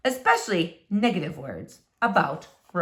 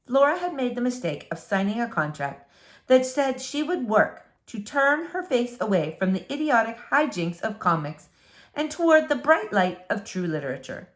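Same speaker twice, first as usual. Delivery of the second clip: ordinary delivery, nothing marked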